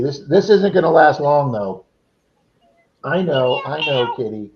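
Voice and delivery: ordinary delivery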